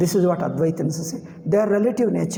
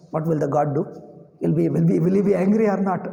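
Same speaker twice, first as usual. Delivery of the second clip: normal talking voice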